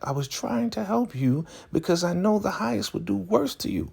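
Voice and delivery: high-pitched imitated justification; mock pleading excuse. Zane justifying herself to Elder Mother, high mock plea. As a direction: put on a high, earnest voice.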